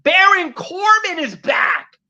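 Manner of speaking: big, high, drawn-out announcement; slow ring-announcer style proclamation; announced shout. Acted. Announcing a wrestler's return.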